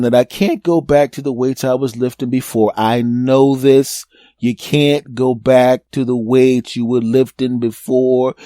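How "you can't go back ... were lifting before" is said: slow, deliberate lecture to oneself; slow self-scolding. Acted. Man telling himself to go lighter, slow and emphatic. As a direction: spell it out word by word, slowly.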